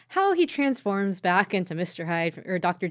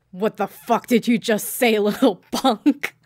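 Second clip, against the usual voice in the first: loud, hammed-up tough-guy challenge; mock menace; 3 semitones higher, 7 dB louder than usual. Evil twin taking over to confront Nagi, loud mock menace. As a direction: confront them with playful menace, drawing out the end.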